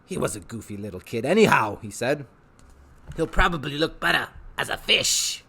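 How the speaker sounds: eager, wheedling showman; a salesman tempting the boy; Rictus voice. Acted. Rictus tempting Harvey with pets, eager showman voice.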